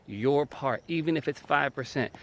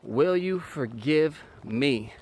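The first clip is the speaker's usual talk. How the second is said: "Will you forgive me" stretched out slowly; drawn-out humble request; slow sincere ask; 3 semitones higher, 3 dB louder, much slower than usual. Shown as a demonstration. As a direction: ask it slowly and let it land. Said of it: "stop talking".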